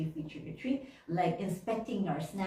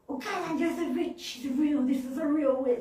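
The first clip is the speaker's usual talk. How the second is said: high, urgent mock panic; hammed-up scared child, pitched up; retold childish fright; 8 semitones higher than usual. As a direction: act out terrified kids warning their parent. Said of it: "scared out of our minds".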